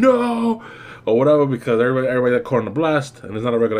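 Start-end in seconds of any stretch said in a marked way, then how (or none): 0.0-0.6 high, drawn-out mock yell; mock scream. Retelling Batman caught in the blast, mock scream. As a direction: imitate Batman's long scream, pitched way up. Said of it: "with his hand out screaming".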